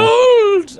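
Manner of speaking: shrieked high and loud "Gold!"; prospector shout. Retold or imitated. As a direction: squeal it like a crazed miner. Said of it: "that's Jesse Cox's voice".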